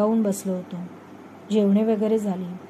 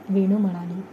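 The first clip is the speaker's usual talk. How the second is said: usual speaking voice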